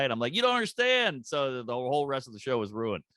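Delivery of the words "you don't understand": high exasperated protest; mock protest. Comic pleading with the hostile crowd, high exasperated protest. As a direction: insist they just don't get it.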